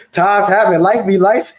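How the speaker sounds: high, laughing shrug of a line. Real, not acted. Shrugging off hard times to viewers, laughing.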